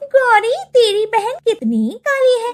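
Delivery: high, mocking then defiant girls; children squabbling in the lane; quarrelling kids. Children quarrel over Neha. (झगड़ती बच्चियों की ऊँची आवाज़)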